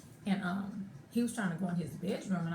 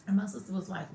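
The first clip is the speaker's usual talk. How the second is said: ordinary delivery, nothing marked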